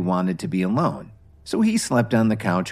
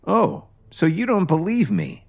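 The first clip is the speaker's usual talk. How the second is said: snapping back; 9 semitones higher than usual. Acted. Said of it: "Kevin snapped back".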